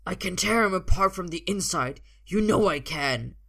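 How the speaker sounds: loud, desperate menace; shouted hostage-taker's warning; yelling threat. A narrator's reading. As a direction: threaten them at full voice. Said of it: "he yells".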